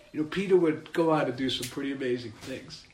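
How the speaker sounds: ordinary talk, nothing marked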